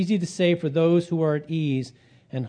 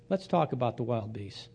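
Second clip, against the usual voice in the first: softer, dry acceptance of the challenge; dry retort; 2 semitones lower, 5 dB quieter than usual. Retold or imitated. Job takes on his friend's argument, dry retort. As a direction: drop it quietly, taking up the challenge.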